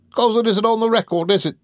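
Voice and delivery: high, loud, aggrieved retort; defiant. A narrator's reading. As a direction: fire back at your master in protest. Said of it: "chafed into perfect defiance".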